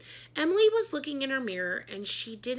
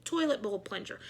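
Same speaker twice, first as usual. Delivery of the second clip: ordinary delivery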